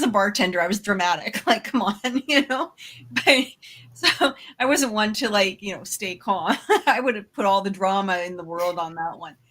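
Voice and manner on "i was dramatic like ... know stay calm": ordinary delivery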